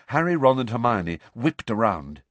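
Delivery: plain reading voice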